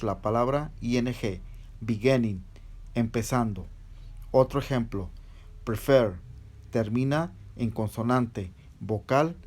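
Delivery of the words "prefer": prefer with the last syllable stronger; "prefer" stressed on its second syllable. Shown as a demonstration. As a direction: say "prefer", leaning on "fer". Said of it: "tiene mayor fuerza de voz en".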